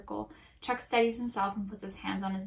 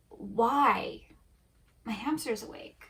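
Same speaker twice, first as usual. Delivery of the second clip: ordinary delivery, nothing marked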